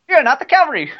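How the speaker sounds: high, loud mock British catchphrase; British Tracer accent. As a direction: play an over-pitched British heroine. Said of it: "did we establish she's British?".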